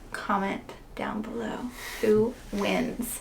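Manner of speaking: whispered aside. Real, not acted. Aside to viewers.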